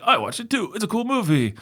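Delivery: very high mock-enthusiastic voice; exaggerated high-pitched agreement; mimicked fan. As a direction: parody a friend chiming in. Mimics friend Jake agreeing.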